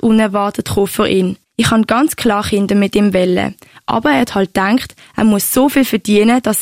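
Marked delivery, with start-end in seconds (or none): none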